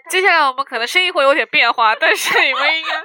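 捏着鼻子说话的声音; 捏住鼻子，闷着说; 捏着鼻子. Real, not acted. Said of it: "就是捏着鼻子在说话". (捏鼻子说话)

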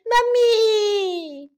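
high, drawn-out mock wail; acted tearful cry. A narrator's reading. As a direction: wail for mother like a little lamb. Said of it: "扑到妈妈的怀里大哭".

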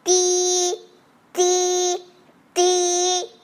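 high, level, sustained beeps; imitated tractor horn. A narrator's reading. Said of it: "按下拖拉机的喇叭".

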